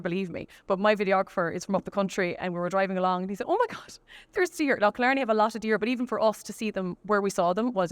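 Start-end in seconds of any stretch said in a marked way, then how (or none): 3.5-4.8 high-pitched astonished exclamation; retold amazement. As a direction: imitate someone suddenly spotting something amazing. Retelling videographer spotting deer.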